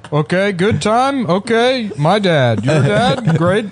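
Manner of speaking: doing a Chinese comic doing Obama nonsense; mock Obama impression. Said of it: "he just, like, speaks nonsense".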